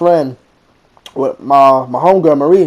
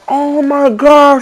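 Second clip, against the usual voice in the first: high, drawn-out mimicked gasp of delight; slow, high-pitched mock squeal; imitated delighted squeal; 10 semitones higher, much slower than usual. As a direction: mimic a girl overwhelmed by a surprise, pitched way up. Girl's reaction to surprise proposal.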